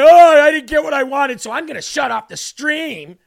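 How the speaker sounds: high, loud whiny crybaby impression, sneering; mocking crybaby voice. Host mocks viewers who sulk and quit the stream, whiny mock voice. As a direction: whine it like a sulking viewer threatening to leave.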